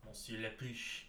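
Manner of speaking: "Monsieur Lapuche" said softly with a French nasal "Monsieur" and a French "Lapuche"; a quiet mock-French take on "Monsieur Lapuche"; mock French accent. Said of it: "your best approximation of Monsieur Lapuche".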